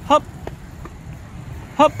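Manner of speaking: bouncy breathy hops mid-jog; calling hop with each running stride; while running. Real, not acted. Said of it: "saya coba sambil lari ya".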